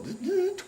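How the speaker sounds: high electronic whirr in two bursts; high-pitched mechanical buzz; imitated machine noise. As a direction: mimic a small motor switching on. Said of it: "I heard that thing go".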